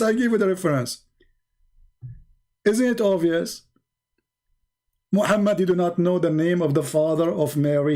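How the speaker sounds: ordinary talk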